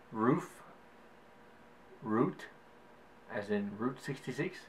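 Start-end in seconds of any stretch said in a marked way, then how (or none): none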